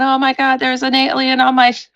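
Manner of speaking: high, excited alarm, words tumbling out; panicked call. Retold or imitated. Roommate calling in a panic about the car, high and alarmed. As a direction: blurt it out in a fright. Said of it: "She calls me in a panic".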